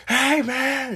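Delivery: loud high shouted greeting. Pretend. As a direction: yell it like a frat bro. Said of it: "only be able to shout".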